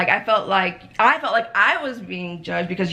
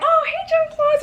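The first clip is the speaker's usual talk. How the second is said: much higher, bright mock-happy hello; cheery greeting. Greeting Jean Claude at practice, high cheery hello. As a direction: sing out the greeting like all is fine. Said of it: "I'm like".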